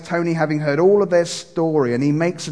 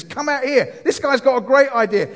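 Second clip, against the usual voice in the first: excited call; 8 semitones higher, 3 dB louder than usual. Retold or imitated. Cafe owner calling wife from back.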